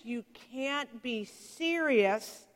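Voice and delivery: ordinary talk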